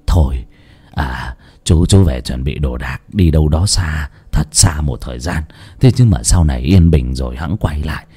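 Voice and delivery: put-on low old man's voice, resigned; a tired old man urging him to flee; weary warning. A narrator's reading. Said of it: "thở dài ngao ngán". (giọng trầm, ngao ngán)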